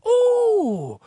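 high, hammed-up Irish pirate ooh; a cartoon Irish pirate's ooh; mock Irish pirate. Pirate reacting to a tourist in a mock Irish accent. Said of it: "he spoke like an Irishman".

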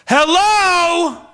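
high, irritated, lost; irritated hello. Retold or imitated. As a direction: snap it up high and annoyed. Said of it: "Like that, like you're irritated".

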